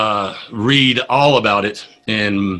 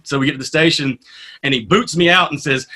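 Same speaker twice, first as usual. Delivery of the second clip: ordinary talk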